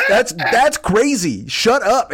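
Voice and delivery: mock outrage at the message; retold exasperated retort. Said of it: "Which was like".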